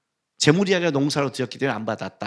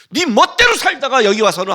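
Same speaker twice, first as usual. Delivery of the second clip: higher, louder accusation; raised rebuke; 7 semitones higher, 6 dB louder than usual. Acted. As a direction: press the charge hard. God's rebuke continues. (높고 크게 다그치며)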